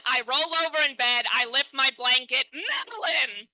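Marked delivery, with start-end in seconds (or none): 2.5-3.5 mock horrified gasp. Discovering eaten melon in bed.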